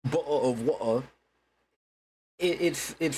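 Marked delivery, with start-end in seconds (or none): 0.0-1.1 hammed-up British accent with glottal stops; mock British slang pronunciation. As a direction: drop the Ts like a caricature Brit. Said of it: "stereotype of British accents being like".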